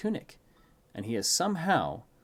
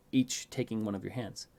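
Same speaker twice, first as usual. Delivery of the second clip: usual speaking voice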